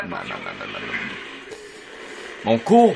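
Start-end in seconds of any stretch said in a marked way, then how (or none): 0.0-1.0 much lower, softer stuck repeat; slowed-down glitch voice; deep mock stutter. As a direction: sink into a broken-record growl. Voice dropping into stutter.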